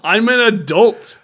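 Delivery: loud, high, slow insistence; defensive protest. Real, not acted. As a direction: stand up for yourself against scolding. Storyteller defends himself when scolded.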